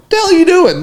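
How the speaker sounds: high-pitched mock disbelief; retold incredulous call-out; bewildered. Bewildered roommate calls out from couch.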